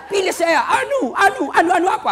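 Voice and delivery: high, loud, laughing voter voice; laughing mock plea. Preacher mocking a confused voter, laughing loud and high. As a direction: cackle through the name Anu.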